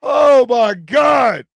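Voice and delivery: slow, drawn-out loud cry; loud exclamation. Real, not acted. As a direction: stretch it out at full voice. Exclaiming after the swamp joke.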